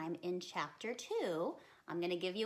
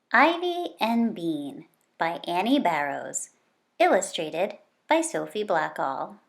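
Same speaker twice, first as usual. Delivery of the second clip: put-on lively, louder read-aloud for kids; story reading; 18 dB louder than usual. Reads the chapter aloud.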